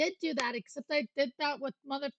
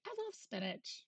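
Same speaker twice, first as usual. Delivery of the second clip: high, much softer sweet little voice; 7 semitones higher, 10 dB quieter than usual. Pretend. Streamer repeating love for spinach, soft high coo. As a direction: coo it quietly to yourself.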